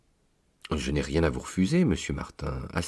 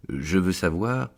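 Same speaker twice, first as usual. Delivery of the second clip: usual speaking voice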